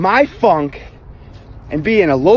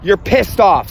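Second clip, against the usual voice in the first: ordinary delivery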